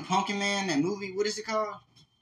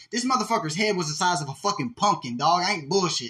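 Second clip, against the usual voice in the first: ordinary delivery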